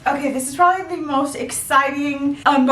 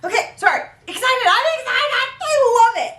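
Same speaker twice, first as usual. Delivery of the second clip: high, squealing excited gush; real breathless-with-delight outburst; overexcited; 10 semitones higher, faster than usual. Real, not acted. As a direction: burst out high, giddy with joy. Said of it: "I'm just so excited".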